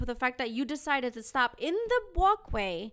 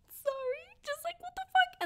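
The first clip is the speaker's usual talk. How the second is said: much higher, squeaky mock of the guy. Mocking the peeing guy to friends, squeaky and high. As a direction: pitch way up to mock him. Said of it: "And this guy is just like".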